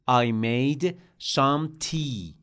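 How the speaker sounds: I made some tea, slow with weight on some; slow model sentence. Shown as a demonstration. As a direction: dictate it gently for the class.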